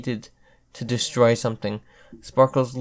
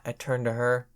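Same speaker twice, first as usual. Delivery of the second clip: plain reading voice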